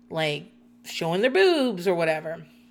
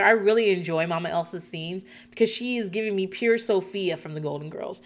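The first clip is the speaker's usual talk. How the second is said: ordinary talk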